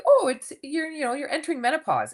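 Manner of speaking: high British doctor voice, imitated; imitated British lady doctor; British accent. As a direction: break it to her in a high British lilt. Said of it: "she had this little British accent".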